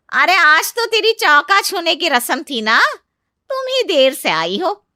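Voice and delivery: loud, high reproach; scolding aunt-in-law. Acted. Aunt-in-law tells the new bride off for coming late, scolding. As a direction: pointedly tick off a latecomer.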